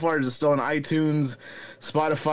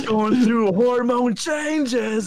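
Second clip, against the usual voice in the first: put-on slow teen voice breaking higher; voice crack; 8 semitones higher, much slower than usual. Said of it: "You got a voice crack while you do it?".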